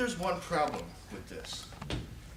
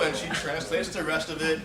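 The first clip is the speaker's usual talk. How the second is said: ordinary delivery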